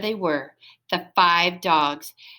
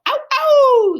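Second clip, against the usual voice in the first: very high, loud double howl; dog pack howling down the hill; 8 dB louder than usual. Acted. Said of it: "they howled".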